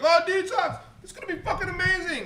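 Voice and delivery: high, loud mock excitement; hyped-up fans. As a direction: squeal it like overexcited fans hyping an album.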